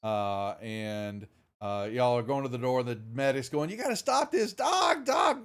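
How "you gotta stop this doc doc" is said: high frantic shout for the doctor; urgent shouting medic; panicked yell. Acted. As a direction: yell for the doc, desperate. GM voices panicked medic yelling.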